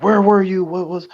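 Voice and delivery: high, urgent imitation of panicked parents; freaking-out parents. Parents confronting their child back from the movies, mock panic. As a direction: mimic worried parents, pitch jumping up. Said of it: "and they're freaking out".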